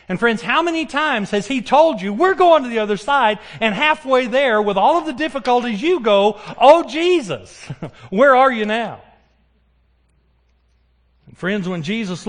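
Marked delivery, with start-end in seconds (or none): 0.1-6.4 raised, ringing sermon voice; fervent preaching. Acted. Preaching at full force. 6.6-9.0 hammed-up plea to Jesus, high and loud; mock cry. Mock plea of a frightened believer.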